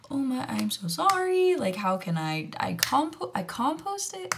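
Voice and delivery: high, slow, put-on flustered sorry; mock shocked apology. To the ticket inspector, a hammed-up shocked apology. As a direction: play the clueless tourist caught out.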